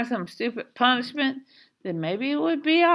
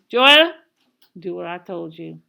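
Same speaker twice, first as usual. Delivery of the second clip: menacing mother's order; 3 semitones lower, 6 dB louder than usual. A narrator's reading. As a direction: call sharply, then drop to a low threat. Mother repeats order, then drops low.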